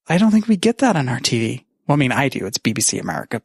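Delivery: usual speaking voice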